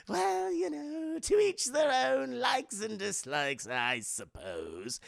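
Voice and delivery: high, slow, mocking villain laugh into a shrug; a giggling villain brushing off an insult; Joker voice. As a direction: sneer it off lazily. Joker dismisses Bruce's insult.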